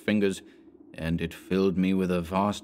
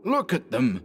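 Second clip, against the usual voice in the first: higher, sharp cry at the dogs; savage cry; 5 semitones higher than usual. A narrator's reading. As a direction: snap at the fleeing dogs. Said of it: "cried Anderson savagely".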